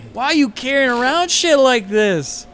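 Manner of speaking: loud, high, incredulous demand; appalled outburst. Acted. Thug confronts player over bag's revolting contents, appalled outburst. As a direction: yell it in appalled disbelief.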